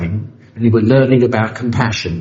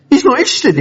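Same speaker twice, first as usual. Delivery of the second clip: squeaky, higher exasperated complaint; 14 semitones higher, 3 dB louder, faster than usual. Retold or imitated. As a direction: whine it like a flustered newcomer. Running back, complaining to Jim.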